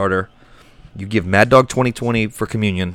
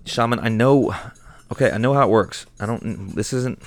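ordinary talk

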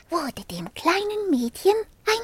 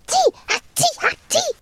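higher mock sneezes. The dragon proves his cold with sneezes, mock sneezes. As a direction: act out sneezing fits.

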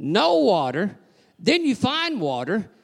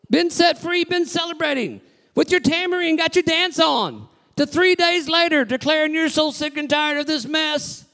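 full-force preaching; 6 semitones higher than usual. Acted. Preacher rebuking the congregation for complaining, full-force preaching.

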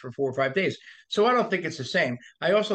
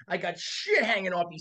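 usual speaking voice

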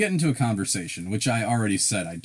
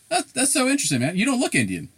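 much higher, louder mock bro praise; mock clerk voice; 5 dB louder than usual. Retelling the clerk saying he doesn't look Indian. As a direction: deliver it as cheerful clueless praise.

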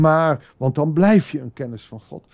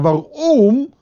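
usual speaking voice

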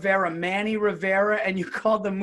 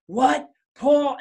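louder, higher "What, Paul?"; an overhearing wife's indignant call; retold sharp call; 6 semitones higher, 4 dB louder than usual. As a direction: catch him out mid-sentence. Wife overhears the confession.

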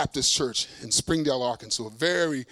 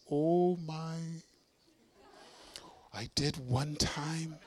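very soft, slow, hesitant confession; soft-spoken mother-in-law; 9 dB quieter, much slower than usual. Retold or imitated. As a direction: imitate a gentle woman who never raises her voice. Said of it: "but she never raises her voice".

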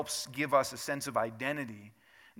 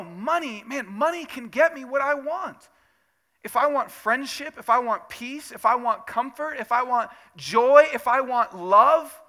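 high, loud, building list of wants; fervent mock enthusiasm; 10 semitones higher, 11 dB louder than usual. Preacher voicing our faith in money, fervent and building. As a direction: swell louder and higher, stretching the final wants.

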